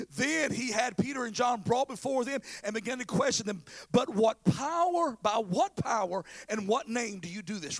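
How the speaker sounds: impassioned scripture delivery; preached scripture reading. Real, not acted. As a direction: declaim the verse from the pulpit.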